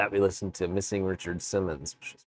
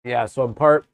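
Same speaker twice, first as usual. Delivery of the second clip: mouth full; 3 semitones higher, 6 dB louder than usual. Real, not acted. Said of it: "talking with my mouth full".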